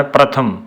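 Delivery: ਪ੍ਰਥਮ with a clear r cluster and aspirated th; ਪ੍ਰਥਮ ਧਿਆਨ ਨਾਲ; careful pratham. Shown as a demonstration. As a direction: spell out ਪ੍ਰਥਮ sound by sound. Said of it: "ਸੋ ਪ੍ਰਥਮ ਬੋਲਣਾ ਹੈ".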